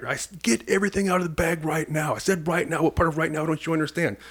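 imitating drill instructor. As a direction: badger the recruit to hurry, imitated. Drill instructor yelling at recruit.

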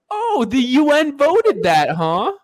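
high, slow sarcastic mock. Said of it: "they're like".

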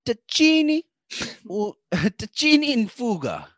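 high, hammed-up Italian mode; sing-song put-on Italian accent; mock Italian accent. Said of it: "let me get my Italian mode going".

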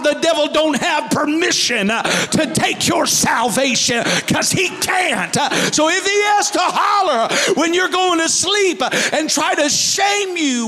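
full-force sermon shouting; preaching. Acted. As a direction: preach it hard over the music.

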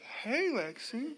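slow, quiet, anxious greeting; a cautious, soft hey like bad news is coming; soft wary hello to a daughter calling unexpectedly; worried slow hey. Shown as a demonstration. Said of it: "it's like".

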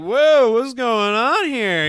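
loud, high, drawn-out mock surprise; hammed-up 'who, me?' outcry; acted startled protest. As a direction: play innocent, loudly and slowly. His startled reply to the cop.

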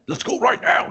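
high breathy eager taunt; squeaky challenger. Retold or imitated. Challenger pestering Hawk to arm wrestle now. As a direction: squeak it out, itching to fight.

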